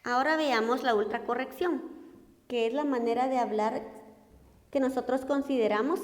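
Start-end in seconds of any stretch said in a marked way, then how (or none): none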